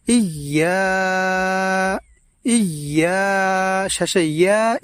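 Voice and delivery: ইয়া held long and slow; stretched ইয়া. Shown as a demonstration. Teacher isolating ইয়া for viewers, drawn long. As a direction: draw the ইয়া out. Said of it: "আওয়াজটাকে এইভাবে লম্বা করে পড়ব".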